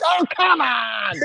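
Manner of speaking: high, drawn-out mock wail; imitated whining protest, way up high; drunken protest. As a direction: wail it slowly like a drunk being thrown out. Drunk friend protests being kicked out.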